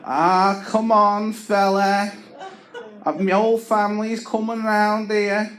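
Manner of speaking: high pleading chancer voice; high wheedling plea; wheedling neighbour Tony. Retold or imitated. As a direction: beg like a cheeky local chancer. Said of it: "He's like".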